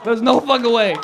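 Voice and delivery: loud, punchy incredulous jab; emphatic disbelief. Real, not acted. Doubting a heckler has a boyfriend, loud disbelief. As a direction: raise the volume in comic disbelief. Escalating disbelief at audience member.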